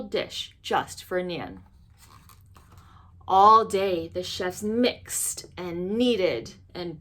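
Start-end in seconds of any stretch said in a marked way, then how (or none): none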